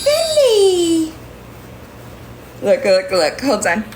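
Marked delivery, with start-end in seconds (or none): 0.0-1.1 high cooing voice to a kitten; squeaky sing-song pet talk. Real, not acted. Calling the cat.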